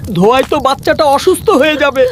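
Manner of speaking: high, distressed concern; troubled and pitying; pained. Acted. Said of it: "খুব কষ্ট পেয়ে সাজু বলল".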